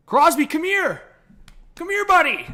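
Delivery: high-pitched call to a pet; calling the dog. Real, not acted. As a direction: coax a dog over in a high voice. Calling his dog over.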